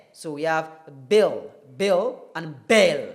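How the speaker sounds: bill then bell, vowel opened; short i versus short e. Shown as a demonstration. Teacher contrasting bill and bell for learners. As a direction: contrast the two vowels clearly. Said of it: "experimenting between".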